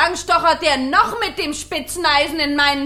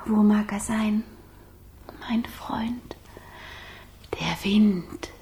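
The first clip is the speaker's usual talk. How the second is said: whisper; 3 semitones lower, 6 dB quieter than usual. Acted. Fire calling softly for friend.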